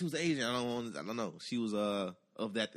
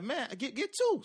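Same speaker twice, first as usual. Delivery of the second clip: cousin's hype voice; 13 semitones higher, slower than usual. Retold or imitated. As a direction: voice jumps high on get two. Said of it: "He's like".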